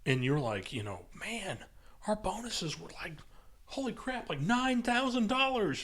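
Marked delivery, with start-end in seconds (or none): 1.1-5.8 high, breathless brag about the bonus; lightly voiced bragging coworker; mock excited bragging. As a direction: gush like a show-off coworker. Mocking coworker's big bonus.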